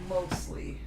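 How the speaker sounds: soft, whispered "Mostly"; teasing whisper. Real, not acted. Teasing partner who says they stayed out of the way, whispered. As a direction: whisper it as a sly aside. Teasing repeat under her breath.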